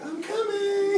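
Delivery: high, sing-song call; woman calling back. Retold or imitated. Scantily dressed woman answers the man, high sing-song call.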